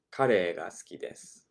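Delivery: ordinary talk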